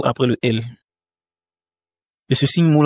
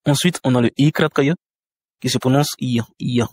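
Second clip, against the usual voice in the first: ordinary talk, nothing marked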